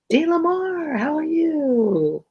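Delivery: high, drawn-out hello to a newcomer; high-pitched, long-held singsong welcome; singsong greeting. Real, not acted. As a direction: sing out the greeting high, stretching the last word. Greets a viewer joining the stream.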